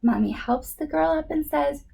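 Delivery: ordinary delivery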